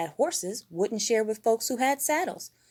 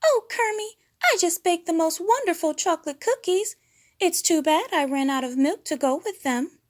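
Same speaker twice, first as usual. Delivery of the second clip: very high, sweet coy voice; high flirty diva voice; Miss Piggy; 11 semitones higher, 5 dB louder than usual. A narrator's reading. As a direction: coo at Kermit, high and fluttery. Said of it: "Miss Piggy appeared".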